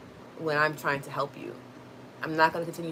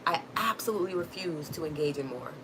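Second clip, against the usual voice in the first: ordinary talk, nothing marked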